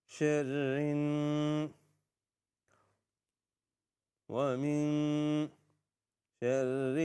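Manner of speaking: slow, measured tajweed recitation on a near-level tone; Quran recitation. Shown as a demonstration. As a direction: recite it slowly with long held vowels. Recites verse in pieces.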